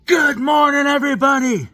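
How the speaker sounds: very high shouted good morning; loud mock greeting. Plumber vlogger parody shouting hello to viewers. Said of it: "John Phillips Plumbing".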